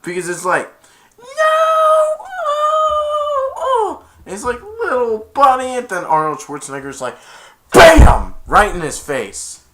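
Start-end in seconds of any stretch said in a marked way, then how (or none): 1.2-4.0 very high, wavering "no"; long mock wail. Big Santa watching his buddy fall, mock wail. 4.6-5.8 high, drawn-out loud mock cry; distraught big Santa. Big Santa over his punched friend, mock anguish. 7.7-8.3 loud, high "bam"; explosive punch noise. Retold or imitated. Arnold punching Santa in the face, explosive imitation.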